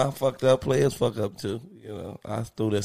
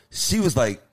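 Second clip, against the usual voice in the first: ordinary talk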